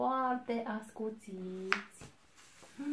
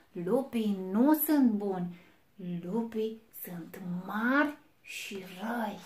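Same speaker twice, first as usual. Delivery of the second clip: slow, loud wolf lecture; big bad wolf; 8 dB louder than usual. A narrator's reading. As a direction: lay down the law of wolves, slowly. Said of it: "lupul cel mare și rău".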